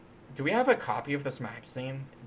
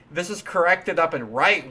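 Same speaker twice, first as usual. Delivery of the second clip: excited; 4 semitones higher, 8 dB louder than usual. Real, not acted. Triumphant fix announcement.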